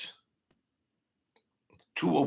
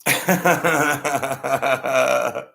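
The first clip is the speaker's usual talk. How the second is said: a short real burst of laughter; 4 semitones higher, 4 dB louder than usual. Real, not acted. Laughing at his own book plug, real laughter.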